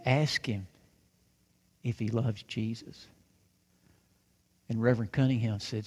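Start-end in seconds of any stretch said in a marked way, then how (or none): 0.0-3.1 put-on soft, low-pitched little girl's request; gentle request. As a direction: ask it quietly, with a pause midway.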